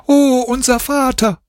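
put-on high excited child's cry; a child calling to the father it missed; children crying out. A narrator's reading. Children calling out to their father, high excited cry. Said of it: "Da schrien die Kinder".

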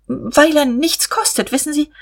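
coy. A narrator's reading. Guste gives Diederich her reason, coyly. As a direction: lift the voice high, confiding coyly.

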